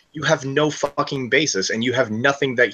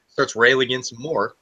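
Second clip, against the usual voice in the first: ordinary talk, nothing marked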